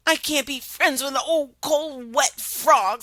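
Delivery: high disgusted princess; high sneering princess. Acted. Princess complains.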